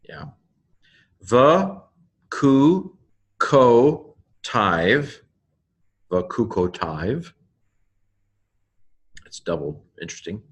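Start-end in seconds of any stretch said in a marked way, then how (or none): 1.2-5.2 higher, separate held syllables. Shown as a demonstration. Teacher breaking down a Hebrew word, syllable by syllable. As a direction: sound out "the, ku, ko, tiv" with gaps between. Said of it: "the Hebrew pronunciation".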